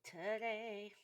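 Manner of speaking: put-on slow, lilting valley girl. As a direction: drawl it like a mall girl. Singing Valley Girl song line.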